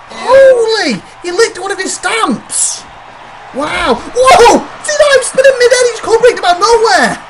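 high, excited ringside shouting; stunned commentator. Pretend. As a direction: call the wild move in high-pitched amazement. Commentator reacts to shocking match moves.

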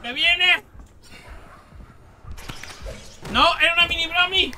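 high, loud pleading yelp; panicked cry. Real, not acted. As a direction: cry out as it comes at you. El bicho le persigue. (grito agudo de pánico)

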